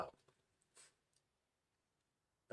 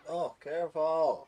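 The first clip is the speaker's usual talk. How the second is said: slow, louder, stretched careful; firm, slow caution at the dog; drawn-out stern warning; 5 semitones higher, 10 dB louder, slower than usual. Real, not acted. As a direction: drag out the warning so the dog listens.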